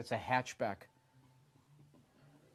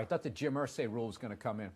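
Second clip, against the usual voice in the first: usual speaking voice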